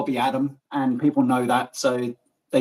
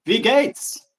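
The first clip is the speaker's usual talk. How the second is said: German greeting; 7 semitones higher than usual. Acted.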